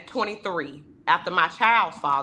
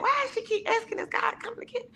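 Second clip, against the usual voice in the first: much higher, softer baffled wondering; puzzled; 6 dB quieter, much faster than usual. Retold or imitated. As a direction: wonder aloud, high and fading. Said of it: "I was like".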